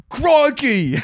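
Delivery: mock cop exclamation. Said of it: "the crikey's Australian".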